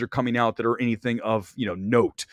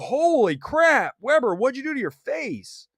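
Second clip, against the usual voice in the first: higher, drawn-out mock alarm; mock shocked viewers; 12 semitones higher, slower than usual. Viewers reacting to their host's botoxed face, mock shocked. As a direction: gasp it like a stunned fan. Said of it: "you guys were like".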